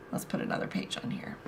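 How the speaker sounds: quick, near-whispered aside; muttering to self. Real, not acted. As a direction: murmur it under your breath while busy. Talking to self while turning pages.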